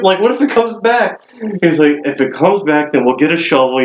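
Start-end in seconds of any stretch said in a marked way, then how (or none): 0.0-1.2 higher, anxious rookie question; mock-worried whine to the boss; retold worried pleading. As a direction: plead lightly, pitched up, as the nervous trainee. Retold worried trainee pleading with leader.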